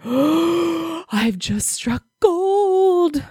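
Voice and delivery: gasping at a friend's gift, overjoyed; retold thrilled gasp. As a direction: replay the moment of finding treasure. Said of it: "And I was like".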